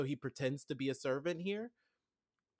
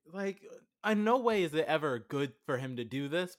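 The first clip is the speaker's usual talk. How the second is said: ordinary delivery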